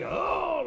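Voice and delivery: high, drawn-out mock lion roar; imitated roar. Storyteller imitates the warrior appearing like a lion, roaring. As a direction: let out a roaring 'oh' well above your talking pitch. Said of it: "like a big roaring lion".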